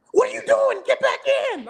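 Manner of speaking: very high, loud coach shout; coach yelling. Retold or imitated. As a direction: bark him back onto the field. Coach yelling to get back in.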